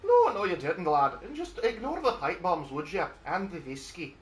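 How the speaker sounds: high, lilting put-on Irish; mock Irish accent. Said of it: "Did I step into an I R A meeting?".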